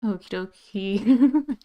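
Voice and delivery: slow, stretched "Okie dokie"; drawn-out okie dokie. Acted. Chicken character starting the scene, slow stretched okie dokie. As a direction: drag out each syllable of "dokie". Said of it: "Shouldn't say okie dokie".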